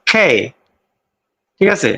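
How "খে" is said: খে said with a strong puff of breath; English-style aspirated খে. Shown as a demonstration. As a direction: breathe out hard on খে. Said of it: "native স্পিকাররা বলছে".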